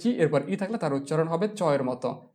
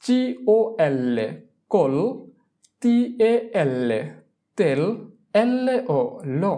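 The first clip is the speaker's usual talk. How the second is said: syllable-by-syllable spelling; 2 semitones higher, slower than usual. Shown as a demonstration. Teacher breaks the word down for learners, slow spelling chant. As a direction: spell each group slowly, then blend it.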